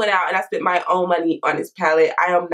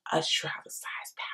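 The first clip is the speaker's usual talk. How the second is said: soft unvoiced whisper; 14 dB quieter than usual. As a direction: whisper it like a secret. Whispered aside about palette size.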